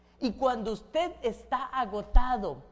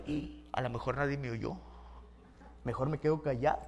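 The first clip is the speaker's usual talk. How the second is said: low, softer murmur to oneself; sheepish acted aside; subdued afterthought; 9 semitones lower, 6 dB quieter than usual. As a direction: drop low, as if hoping nobody heard. Acted afterthought after yelling, keeping quiet.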